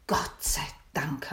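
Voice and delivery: slow, breathy sigh of relief. Acted. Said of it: "hab ich geseufzt". (erleichtert geseufzt)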